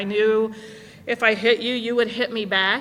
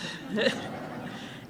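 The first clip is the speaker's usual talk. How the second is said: breathy laugh; 9 dB quieter than usual. Real, not acted. Laughs at sponsee's joke.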